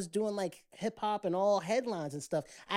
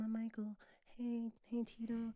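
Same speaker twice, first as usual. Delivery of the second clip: much softer, slightly higher, gentle public voice; 4 semitones higher, 10 dB quieter than usual. Retold or imitated. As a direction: coo it softly like a shy pop star. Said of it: "[name] used to talk like this".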